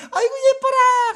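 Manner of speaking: put-on high, crying coo over a baby; doting grandparent. Grandparent cooing at a grandchild, high and tearful.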